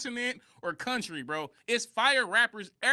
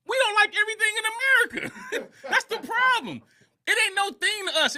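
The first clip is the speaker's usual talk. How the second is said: laughing; 13 semitones higher, 3 dB louder than usual. Real, not acted. Laughs mid-argument, voice jumps up.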